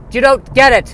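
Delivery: high, loud exasperated insistence; mock politician losing patience; hammy outburst at a colleague; frustrated senator. Senator hearing, mock speeches.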